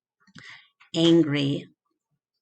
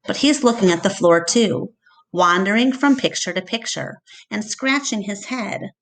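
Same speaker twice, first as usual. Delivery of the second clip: usual speaking voice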